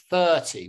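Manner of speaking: thirty with a strong THIR, light falling ty; THIR-ty, crisp teacher demo; stress on first syllable. Shown as a demonstration. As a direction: punch the first half of "thirty". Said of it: "The first syllable".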